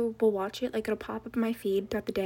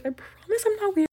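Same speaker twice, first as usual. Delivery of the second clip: high squeal; 10 semitones higher, 9 dB louder than usual. Acted. Repeats line, second much higher.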